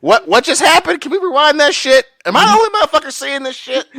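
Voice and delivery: high, loud, fast astonished rant; baffled disbelief. Retold or imitated. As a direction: blurt it out as if you can't believe your eyes. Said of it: "I'm just like".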